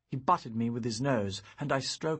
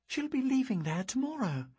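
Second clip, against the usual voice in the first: put-on much higher girl's voice, countering; young girl objecting; 12 semitones higher than usual. Girl reminding her friend the mother leaves tomorrow, high objecting voice. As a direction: point it out quickly as the girl.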